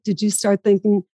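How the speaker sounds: normal talking voice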